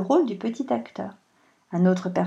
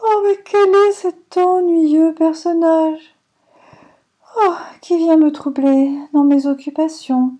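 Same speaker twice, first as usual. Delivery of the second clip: plainte aiguë, lente et plus forte, pleine de lassitude; gémir comme une dame enrhumée qu'on dérange; voix lamentable; 6 semitones higher, 6 dB louder than usual. Acted. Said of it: "lui dit d'une voix lamentable". (madame la pluie se lamente d'être dérangée, voix plaintive)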